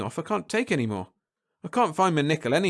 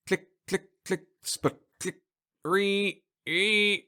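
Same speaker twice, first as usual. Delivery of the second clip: machine clicks; 5 semitones higher, much slower than usual. Acted. Popcorn machine noises.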